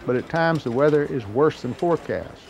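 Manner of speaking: ordinary talk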